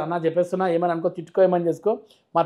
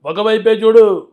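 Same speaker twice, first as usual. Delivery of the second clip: slow, loud warning rising to a higher roar; Balayya punch dialogue; 3 semitones higher, 11 dB louder, slower than usual. Retold or imitated. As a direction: build the threat like Balayya. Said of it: "ఒక మంచి dialogue".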